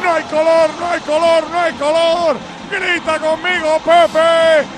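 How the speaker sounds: repeated gol in a pounding, triumphant chant; goal celebration. Real, not acted. Commentator celebrates Rodrigo's goal with listeners, shouted rhythmic chant. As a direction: drive the celebration on, stretching the scorer's name.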